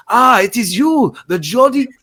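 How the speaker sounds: put-on high, loud, slow German accent; excited mock-German greeting, pitched well up; imitated German accent. German fella running over to greet her, German accent. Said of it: "this German fella, uh, comes running over".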